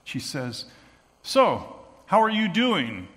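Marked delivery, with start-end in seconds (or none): 1.2-3.1 casual, breezy, higher and louder; cool hostess. Retold or imitated. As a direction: toss it off coolly. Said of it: "how cool she is".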